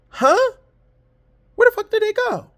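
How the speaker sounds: high, loud shocked cry; shocked disbelief. Retold or imitated. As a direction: yelp it out in total disbelief. Retold shock at closed store.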